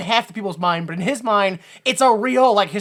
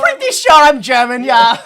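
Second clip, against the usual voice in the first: mock villain; 7 semitones higher, 11 dB louder than usual. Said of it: "joins the bit".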